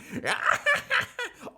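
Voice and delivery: high cackling scream; witchy laugh. Retold or imitated. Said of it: "Rue absolutely scream her iconic".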